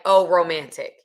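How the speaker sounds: ordinary talk, nothing marked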